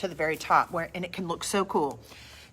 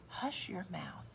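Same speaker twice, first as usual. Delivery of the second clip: mock mother's hush; 13 dB quieter, slower than usual. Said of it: "did your mother ever say to you".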